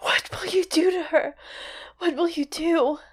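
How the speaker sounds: high, breathy, strained plea; trying to cry out. A narrator's reading. Horrified witch asking what Coulter will do, weakly crying out. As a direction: whimper it in a frightened half-whisper. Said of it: "she tried to cry out".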